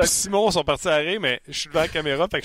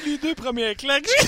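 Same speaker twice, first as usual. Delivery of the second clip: laughing; 8 semitones higher than usual. Real, not acted. Retelling the slaps, giggling.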